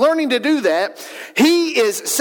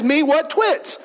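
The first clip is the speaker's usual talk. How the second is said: higher-pitched imitation of an earnest little boy; emphatic toddler; 5 semitones higher, slower than usual. Son says it again to his brothers, lightly imitated emphatic toddler. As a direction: mimic a small child insisting. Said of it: "he's emphatic and he, he is passionate".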